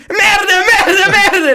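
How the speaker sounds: very high hammed-up French shout; mock calling out. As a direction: imitate a frantic driver yelling curses. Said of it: "en die roept".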